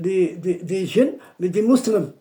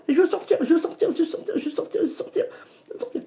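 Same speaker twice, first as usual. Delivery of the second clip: high pleading; 10 semitones higher, much faster than usual. Retold or imitated. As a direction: beg to get out. Djinn begging to leave. (supplication aiguë du djinn)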